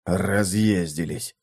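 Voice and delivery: low, slow grumble under the breath; muttered. Acted. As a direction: mutter it sourly. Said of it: "Один буркнул".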